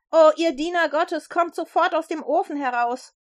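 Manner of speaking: high, loud summons; king calling out. A narrator's reading. King summons God's servants from the furnace, calling loudly. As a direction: call them out urgently. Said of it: "rief er".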